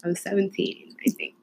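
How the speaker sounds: soft, whispery mumble; hushed. Real, not acted. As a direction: drop to a near whisper. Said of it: "Why are you talking like that?".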